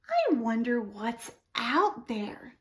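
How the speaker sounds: slow whispered wonder; inner thoughts whisper. Acted. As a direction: whisper the owl's private thought. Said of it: "So he's thinking thoughts".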